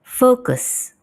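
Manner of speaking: Focus said with a rounded British o, high and falling; British pronunciation. Shown as a demonstration. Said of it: "How do we pronounce this?".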